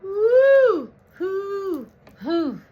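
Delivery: high loud whoop shaking off the cold; cold shiver whoops. Real, not acted. Vlogger in a cold room whooping as he shivers. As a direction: hoot against the chill. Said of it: "Burr's getting cold in here".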